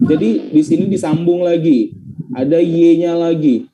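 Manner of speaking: normal talking voice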